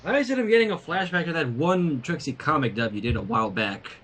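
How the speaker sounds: usual speaking voice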